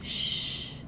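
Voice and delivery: soft unvoiced shh; hushing. A narrator's reading. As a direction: hush him without voice. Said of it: "the giver said".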